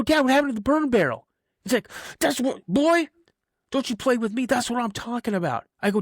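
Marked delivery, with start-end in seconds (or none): 0.0-1.3 high, loud, quick exclamation to dad; acted boyish alarm; shocked kid. Shocked kid finds the barrel gone. 2.2-5.7 high, worked-up fatherly scolding; mock an exasperated dad telling off his boy; retold outburst, pitch shooting up; angry dad impression.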